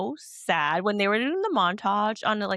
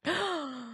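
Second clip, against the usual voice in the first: high, soft gasp; 9 semitones higher, 7 dB quieter than usual. Real, not acted. Co-host reacting as Jesse comes up, high soft gasp. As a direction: suck in a quick, audible breath.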